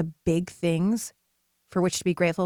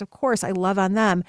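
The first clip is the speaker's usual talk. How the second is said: ordinary talk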